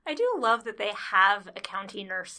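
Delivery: ordinary talk, nothing marked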